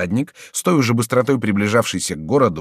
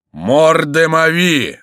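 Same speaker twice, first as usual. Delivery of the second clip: loud, high, slow-stretched oath; thunderous shout; 7 semitones higher, 7 dB louder, much slower than usual. A narrator's reading. Rider standing in his stirrups, shouting thunderously. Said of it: "закричал он громовым голосом".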